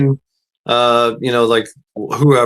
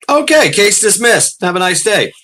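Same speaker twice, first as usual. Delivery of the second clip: brisk judge; 7 semitones higher than usual. Retold or imitated. Judge abruptly dismisses the case.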